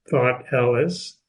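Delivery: normal talking voice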